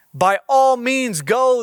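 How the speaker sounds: king readily giving permission; emphatic consent. Acted. Acted king's reply in Bible reading.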